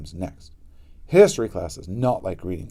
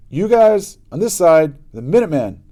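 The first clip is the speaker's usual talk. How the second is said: bossy shout; 7 semitones higher, 7 dB louder than usual. Acted. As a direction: boss the gang around at full voice. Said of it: "said Pinky".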